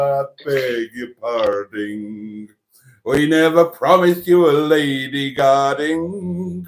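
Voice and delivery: slow sung line in a mock Norfolk accent. Cohost hijacking the song in a mock Norfolk accent. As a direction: sing it in a put-on Norfolk accent. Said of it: "You trying to put on a Norfolk accent there?".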